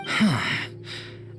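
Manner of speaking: long, airy sigh; resigned sigh. Acted. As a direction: let out a conceding sigh. Gives in to the argument.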